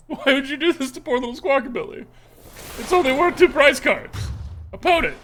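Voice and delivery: high, hammed-up pleading with the opponent; mock outrage. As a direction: protest in pretend anguish for a poor little victim. Mock pleading with opponent over card.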